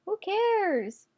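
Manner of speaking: high sing-song. Real, not acted. Repeats it higher.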